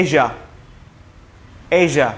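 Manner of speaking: এশিয়া said "eizha" with a জ sound; z sound in Asia. Shown as a demonstration. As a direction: voice the middle of এশিয়া as জ. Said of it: "একটা জ sound আসতেছে". (এশিয়া শব্দে জ ধ্বনি)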